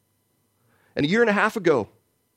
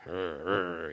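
grumbling growl; 7 semitones lower, 10 dB quieter than usual. Retold or imitated. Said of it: "He's like".

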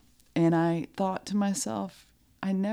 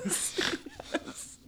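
laughing; 10 dB quieter than usual. Real, not acted. Laughing yes.

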